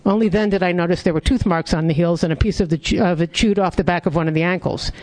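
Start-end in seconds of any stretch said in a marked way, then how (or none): none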